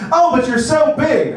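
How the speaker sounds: high, loud hammed-up cry; mock loud moan. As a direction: yell it out on purpose. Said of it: "you can yell that part louder".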